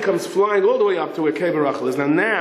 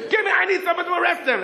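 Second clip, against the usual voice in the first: high, rushed yell; frantic demand; 14 semitones higher, faster than usual. Retold or imitated. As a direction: wrestle for the radio, voice pitched up. Patrolman fights for the radio.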